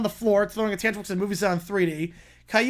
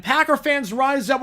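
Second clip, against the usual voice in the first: ordinary talk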